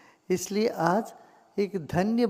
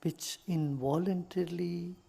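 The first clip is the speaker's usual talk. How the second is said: calm meditation guide with long pauses; guided meditation; 4 dB quieter than usual. Real, not acted. Teacher leading listeners into gratitude for body and breath, meditative. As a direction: guide them gently into stillness, unhurried.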